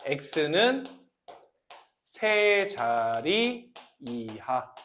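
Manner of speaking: very slow, drawn-out reading, softly spoken; slow, low model reading for students; demonstrative read-aloud. Shown as a demonstration. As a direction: stretch each syllable as you read it out. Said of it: "이거는 이렇게 읽는 거예요".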